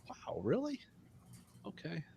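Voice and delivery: high, hammed-up disbelief; mock shocked. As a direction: fake a stunned wow. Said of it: "It's like".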